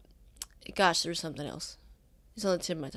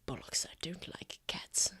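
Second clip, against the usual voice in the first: whispering; 3 semitones lower, 12 dB quieter than usual. Acted. Thalen grumbling under breath.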